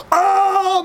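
sudden high startled cry; high sharp scream of fright; startled yelp. Retold or imitated. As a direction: yelp in fright at the dark escalator. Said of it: "I just go".

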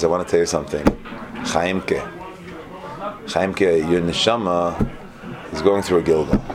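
lightly acted sage, low and measured; solemn Rebbe. Rebbe revealing a secret to his chassid, mock solemn.

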